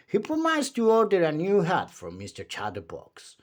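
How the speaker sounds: plain reading voice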